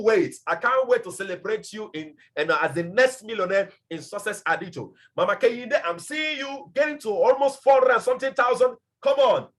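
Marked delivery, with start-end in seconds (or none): 5.8-9.5 rallying shout to a member; real excited cheering. Real, not acted. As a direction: cheer her on at full voice.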